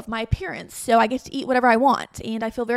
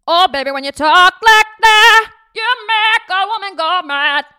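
louder, higher, slow warmed-up [name] imitation; better [name] impression; 9 semitones higher, 13 dB louder than usual. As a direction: go all out on [name] this time. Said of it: "I think I can do better".

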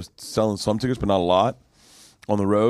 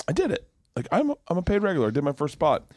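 relieved elation; 8 semitones higher, faster than usual. Retold or imitated. Private moment of relief after first spot.

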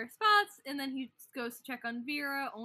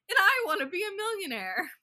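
a higher, louder gleeful shout; excited shout; 9 semitones higher, 9 dB louder than usual. Retold or imitated. Retelling Alfie's line to friends, a loud gleeful shout. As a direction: blurt out the wish with glee. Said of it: "Alfie goes".

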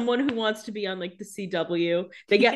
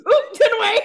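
high, loud hammed-up alarm; mock panicked yelp; 12 semitones higher, 8 dB louder than usual. Co-host mocking a scared cameo, high loud yelp. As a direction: yelp it like a panicked cast member. Joking about a monster cameo.

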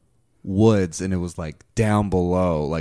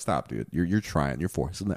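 retold reply; 4 semitones lower, 7 dB quieter than usual. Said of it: "I'm like".

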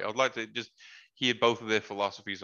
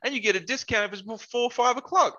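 higher-pitched, mildly hammed-up enthusiasm; imitating excited friend; 8 semitones higher than usual. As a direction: eagerly sell it like an excited pal. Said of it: "his excitement for the day".

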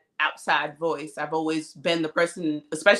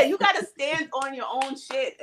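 higher, emphatic retold rebuke; mock scolding; 8 semitones higher than usual. Partner telling her to own her actions, mock scolding. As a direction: imitate a partner insisting on accountability.

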